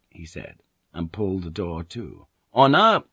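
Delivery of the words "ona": loud, raised call for Ona. A narrator's reading. Blackthorne calling for Ona through the house, loud. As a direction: call her name down the hallway. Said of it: "he called out".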